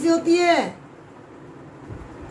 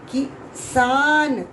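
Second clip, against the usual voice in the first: slow sounding out; much slower than usual. Shown as a demonstration. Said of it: "teacher sounding out a word".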